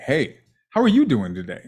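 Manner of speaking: greeting with "you" punched; stress on "you". Shown as a demonstration. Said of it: "with a different voice inflection".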